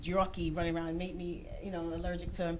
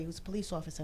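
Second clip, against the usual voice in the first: ordinary delivery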